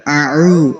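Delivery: اعو with the alif turned into an ain; wrong pronunciation. Shown as a demonstration. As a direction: show the mistake, alif made throaty like عین. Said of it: "اس طرح نہیں کر دینا". (اعو میں الف کو عین بنا کر)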